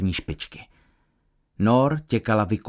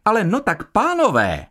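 high-pitched appeal to quarrelling men; scolding woman; 9 semitones higher than usual. A narrator's reading. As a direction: step in and call them to order, voice high. Woman breaks up two furious men. (napomínající dáma)

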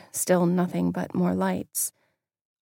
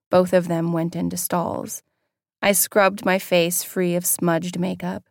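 ordinary delivery, nothing marked

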